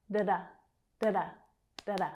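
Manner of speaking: "Da-da" as quick, short duh-duh; fast unstressed syllables. Shown as a demonstration. Teacher showing how fast unstressed syllables are, quick "Da-da". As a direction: rattle off each "Da-da" very short. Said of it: "the others are so fast".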